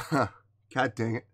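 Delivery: soft, slow, frustrated at the tears; really overcome, low and halting; choked up. Real, not acted. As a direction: curse softly while holding back tears. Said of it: "why I'm getting choked up".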